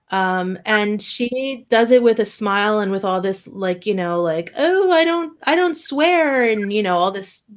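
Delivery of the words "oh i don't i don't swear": a light mock of prim innocence; goody-goody Annie. Mocking Annie's goody-goody manner, high prim voice. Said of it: "goody-goodiness".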